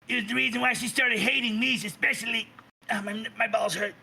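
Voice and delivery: a hint of petty bickering between figures. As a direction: snipe back and forth. Quarrelling figures voiced.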